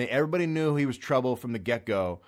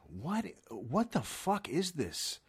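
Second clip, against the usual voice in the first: incredulous; 5 semitones higher, 5 dB quieter, much slower than usual. Real, not acted. Incredulous aside.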